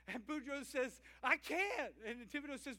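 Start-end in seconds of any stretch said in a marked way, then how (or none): 1.2-1.9 loud, high refusal; loud protest. Acted. Boudreaux shouting back at Thibodeau from the wagon, loud protest. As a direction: blurt it out, louder and higher.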